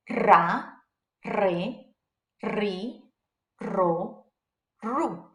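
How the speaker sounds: rolled double R in Ra, re, ri, ro, ru; strong R. Shown as a demonstration. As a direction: roll the R long in Ra, re, ri, ro, ru. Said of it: "always gonna make a strong R sound".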